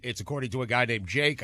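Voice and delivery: ordinary talk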